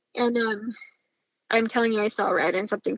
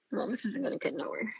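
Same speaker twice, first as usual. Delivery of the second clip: much softer, resigned inner voice; hushed, giving-up aside; defeated thought; 10 dB quieter than usual. Retold or imitated. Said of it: "I just kind of feel defeated".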